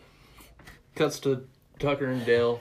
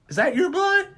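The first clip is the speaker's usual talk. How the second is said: high, louder mock voice; high squeaky voice; 5 dB louder than usual. Friend acting out Tucker, high and squeaky. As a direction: squeal it like a cartoon. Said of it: "he's like".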